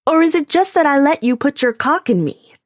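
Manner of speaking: mocking, cruel challenge; biting jab. A narrator's reading. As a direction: push him even harder. Calliope pushes even harder.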